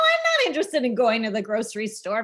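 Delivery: high, loud, half-laughing protest; mock exasperated protest. Podcaster acting out refusing a long grocery trip, mock exasperated.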